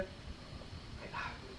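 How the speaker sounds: slow voiceless whisper. Shown as a demonstration. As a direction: breathe it like a secret. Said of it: "that's whisper".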